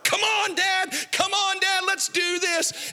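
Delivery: high-pitched imitation of an eager boy; excited kid. Ten-year-old pulling dad to the roller coaster, excited. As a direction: yell it like a thrilled kid, way up high. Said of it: "he's all excited".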